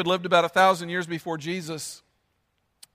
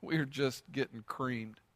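weary listener; 5 semitones lower, 12 dB quieter, slower than usual. Retold or imitated. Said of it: "you'd say".

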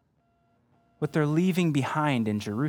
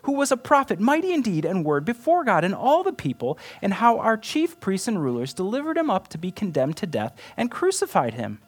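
raised, pleading voice of grieving travelers; disciples' lament; 11 semitones higher, 5 dB louder than usual. Acted. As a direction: read it as their anguished answer. Reading disciples' despairing reply.

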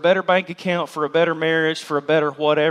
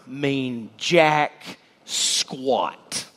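very slow, drawn out dismissal; dragged out; 4 semitones lower, 3 dB quieter, much slower than usual. Real, not acted. Preacher waving off works as worthless, very slow and drawn out. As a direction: stretch out every word with scorn. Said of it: "preacher dismissing works".

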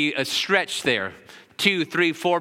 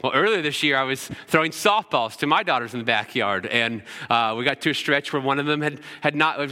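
ordinary delivery

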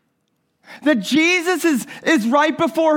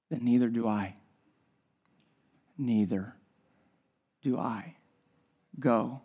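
low, hushed preaching, much softer and lower, unhurried; soft intimate sermon; 11 semitones lower, 7 dB quieter than usual. Acted. Preacher telling his congregation of Jesus and the woman, hushed and intimate. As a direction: draw the room in close with a quiet voice.

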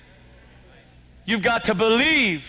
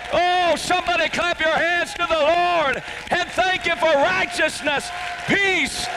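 full-force preaching; 10 semitones higher than usual. Acted.

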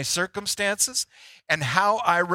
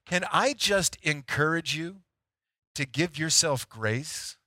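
softer, slowing, tender appeal; gentle encouragement; 2 semitones lower, 5 dB quieter than usual. Real, not acted. Preacher urging listeners to be kind to themselves, softly and gently. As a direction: ease down into a quiet, kindly plea.

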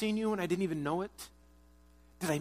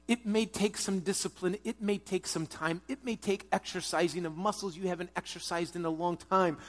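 ordinary talk

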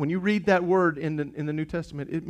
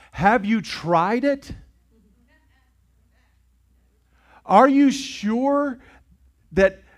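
louder, higher, slow insistent preaching; preaching at full force; 7 semitones higher, 7 dB louder, slower than usual. Acted. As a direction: press the crowd with each question.

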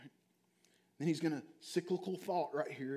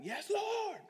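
high shouted Yes, Lord from the pews; mock congregation shout. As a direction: play a fired-up churchgoer calling out, high-pitched. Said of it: "somebody had been like".

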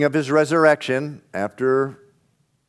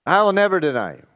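faster, a touch higher, pressing harder; insistent repeat; 6 semitones higher, faster than usual. Acted. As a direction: Peter insisting, pushing the promise. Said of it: "So Peter says".

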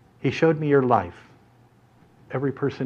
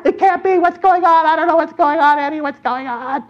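put-on very high, frantic kid babble; panicked disbelief; 13 semitones higher, 3 dB louder than usual. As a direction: squeal it in hammed-up panic. Boys panicking as ramp points at drop.

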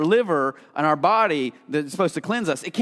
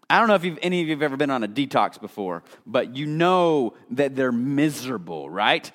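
ordinary talk

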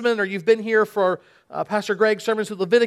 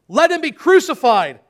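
higher, louder crowd cry; crowd shout; 6 semitones higher, 7 dB louder than usual. Acted. Crowd calls for crucifixion to Pilate, loud shout.

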